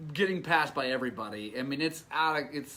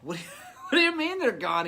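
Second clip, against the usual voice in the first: higher, faster and laughing in disbelief; incredulous, laughing; 8 semitones higher, 4 dB louder, faster than usual. Retold or imitated. Told the wheels are gone, he asks, laughing in disbelief.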